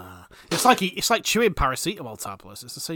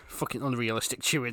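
normal talking voice

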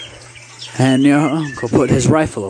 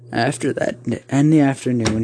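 plain reading voice

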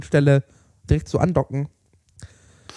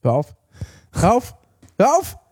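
rising exasperation; 5 semitones higher than usual. Real, not acted. Annoyed at the echo joke, pitch climbing.

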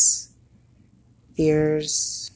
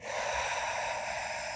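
soft, voiceless exhale; quiet meditation sigh; long releasing sigh; 14 dB quieter than usual. Shown as a demonstration. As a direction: breathe out slowly to let tension go. Exhales to model using breath.

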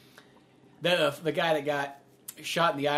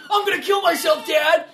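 high, loud yell to Dad; hammed-up child shouting a threat; mock distraught kid; 12 semitones higher, 8 dB louder than usual. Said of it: "where the kid goes".

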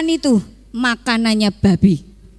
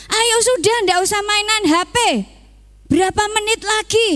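much higher parent voice nagging about the phone; nagging mom; 11 semitones higher, faster than usual. Acted. A parent scolding a child to put the phone down, nagging. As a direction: play the mom hurrying the kid off the phone.

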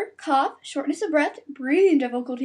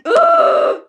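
high loud ugh of despair; frustrated shriek; 10 dB louder than usual. Real, not acted. Fed up with the outbreak, loud groan. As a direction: let out a shrill groan. Exasperated outburst.

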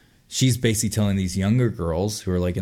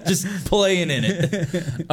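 laughing; 9 semitones higher than usual. Retold or imitated. Joining the joke about the old man.

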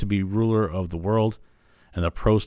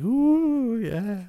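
swooning henchman; 14 semitones higher than usual. Retold or imitated. Mimics fawning henchman swaying.

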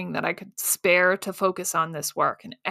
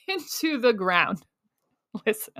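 laughing at herself; 2 semitones higher than usual. Real, not acted. Podcaster laughing at her own drama. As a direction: chuckle as you echo the phrase.

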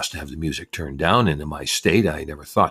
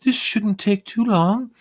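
high, slow, louder lady calling out; shouting census lady; 5 dB louder, slower than usual. Retold or imitated. Census woman shouting over loud music, high voice. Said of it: "one of them shouted".

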